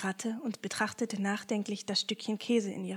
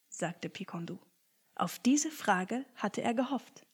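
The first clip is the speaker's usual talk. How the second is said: ordinary delivery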